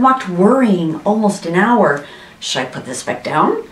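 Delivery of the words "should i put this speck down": quick, low worried murmur; understated, hurried worry; alarmed thought. A narrator's reading. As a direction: think it aloud softly with mild alarm. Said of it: "Horton thought with alarm".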